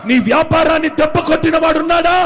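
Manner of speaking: loud, high fervent preaching cry; preaching at full force. Acted. Preacher to his congregation, shouting with full fervour. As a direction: rouse the congregation with rising shouts.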